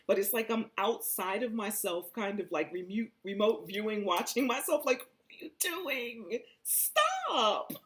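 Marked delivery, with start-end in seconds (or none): 5.0-7.7 high, laughing cry of stop; laughing plea. Retold or imitated. Scolding herself mid-trip, high and laughing. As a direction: beg yourself to stop through laughter.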